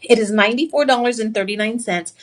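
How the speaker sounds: normal talking voice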